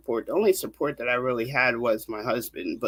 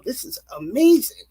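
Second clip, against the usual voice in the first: awed joy; 9 semitones higher, 4 dB louder than usual. Retold or imitated. Overjoyed prayer of thanks.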